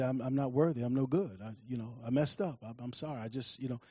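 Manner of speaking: subdued, faltering admission of failure; defeated child. Acted. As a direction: confess quietly, beaten up inside.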